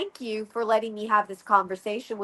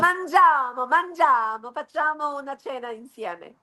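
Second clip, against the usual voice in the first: higher, sing-song Italian; booming sing song welcome; 5 semitones higher than usual. Pretend. Playful Italian sign-off.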